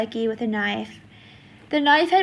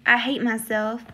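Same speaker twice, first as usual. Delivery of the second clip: ordinary delivery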